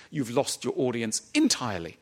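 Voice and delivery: ordinary delivery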